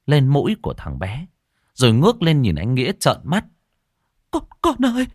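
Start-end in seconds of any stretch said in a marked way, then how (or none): 4.3-5.1 very high desperate wailing to her husband; horrified mother wailing at her husband; frantic wail. Acted. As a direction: cry out in horror, begging. Mother finds baby dead.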